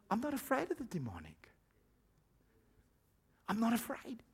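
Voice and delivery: hushed, unworried declaration; quiet confidence. Acted. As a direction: say it softly, as a settled private conviction. Acts out calm, fearless believer.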